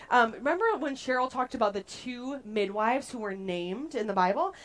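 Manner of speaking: normal talking voice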